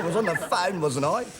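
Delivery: ordinary delivery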